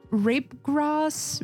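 ordinary talk

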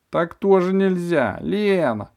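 high, loud, slow cry; shout. A narrator's reading. Dentist shouts for her nurse. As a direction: call out in alarm. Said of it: "закричала она".

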